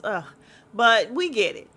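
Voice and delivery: usual speaking voice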